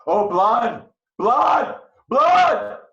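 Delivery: high, loud, rising scream of blood; bloodthirsty scream. Acted. Husband screams for blood as his ensign urges patience.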